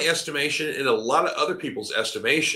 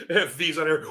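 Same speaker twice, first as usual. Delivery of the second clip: laughing; 7 semitones higher than usual. Real, not acted. Laughing at Hogan booing.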